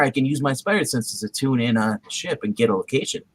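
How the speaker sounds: ordinary talk, nothing marked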